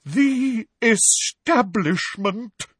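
very slow, high theatrical pronouncement; dramatic, wavering. A narrator's reading. Professor naming who insults her, dramatic and wavering. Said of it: "in a deep, dramatic, wavering voice".

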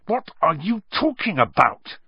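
high, sharp angry demand; angrily. A narrator's reading. Harry angrily demanding what Malfoy means, sharp and high. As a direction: snap it out angrily. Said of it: "said Harry angrily".

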